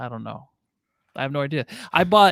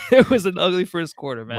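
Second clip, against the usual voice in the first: laughing; 5 dB louder than usual. Real, not acted. Laugh heard.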